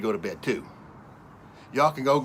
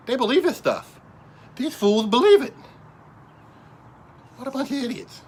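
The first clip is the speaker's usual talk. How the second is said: much higher, louder outburst at the fools; outraged; 13 semitones higher, 5 dB louder than usual. Real, not acted. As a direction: explode with scorn at the idiots. Ranting at media viewers.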